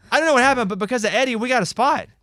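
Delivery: high, loud, laughing through it; laughing while telling it. Real, not acted. Laughing retelling.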